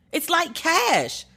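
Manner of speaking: "It's like cash" said slowly and high, each word stretched; livid. Real, not acted. Venting at the bank, slow high incredulous "It's like cash". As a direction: drag out "cash" in outraged disbelief. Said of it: "Can you hear that I'm livid?".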